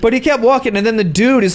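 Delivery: normal talking voice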